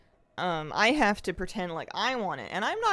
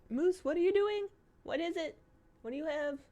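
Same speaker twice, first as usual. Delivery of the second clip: pet talk; 9 semitones higher than usual. Real, not acted. Questioning the dog Moose.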